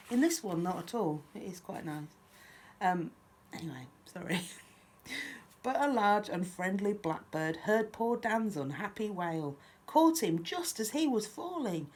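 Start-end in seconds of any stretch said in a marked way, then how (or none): 5.6-11.9 lively, lilting picture-book reading; children's story narration. A narrator's reading. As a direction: read a rhyme aloud to little ones. Reads picture book aloud.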